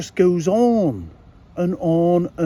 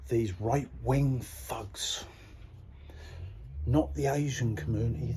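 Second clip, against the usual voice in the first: much softer, slow; low and quiet; 5 semitones lower, 11 dB quieter than usual. Real, not acted. Telling viewers quietly, keeping his voice down. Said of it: "I gotta be quiet".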